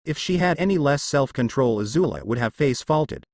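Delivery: usual speaking voice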